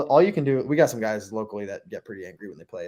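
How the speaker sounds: ordinary delivery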